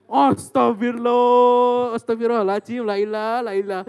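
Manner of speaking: loud, very slow, drawn-out cry of dismay; mock shocked exclamation. Son asked by mother to sing, loud mock horror. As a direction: stretch it out as if scandalized.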